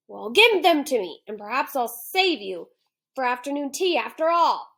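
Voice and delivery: a cranky lion who means to eat him; hungry lion voice. Acted. Lion telling the boy he'll eat him now, loud hungry growl.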